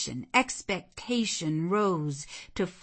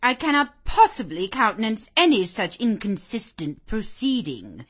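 louder, raised dowager's objection; disapproving; 5 semitones higher, 8 dB louder than usual. Acted.

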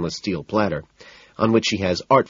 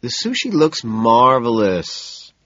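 slow, soothing reassurance; calm her down gently; 5 semitones higher, 4 dB louder, slower than usual. A narrator's reading. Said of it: "I tell her soothingly".

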